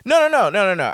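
sudden loud, high protest cutting in. Real, not acted. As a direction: shoot the idea down fast and loud. She jumps in to protest the point.

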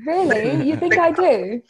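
mock-flattered British questioning; British accent. Friend fishing for praise on their accent, British accent.

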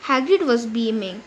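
usual speaking voice

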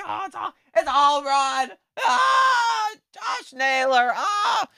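high, shouting cheer, voice cracking with joy; excited home run cheer. Retold or imitated. Fans at the ballpark screaming at a home run, excited high shout. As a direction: yell it like the ball just left the park.

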